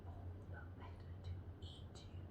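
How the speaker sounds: very low, fast whisper; hissed wolf threat. Shown as a demonstration. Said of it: "And the wolf says".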